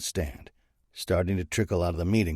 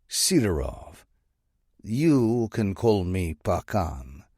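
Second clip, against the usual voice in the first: put-on slow, deliberate Russian-accented boss voice; a light Russian accent, savouring the title; slower than usual. Bratva boss introducing himself on the phone, lightly Russian-accented. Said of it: "a voice laced with a Russian accent".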